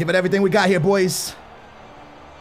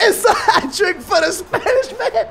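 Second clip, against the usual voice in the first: laughing goal shout; 10 semitones higher than usual. Real, not acted. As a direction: laugh and scream the hat trick. Hat trick goal.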